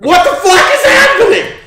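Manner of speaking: high, loud disbelief; shocked yell. Real, not acted. Chaos around the tower.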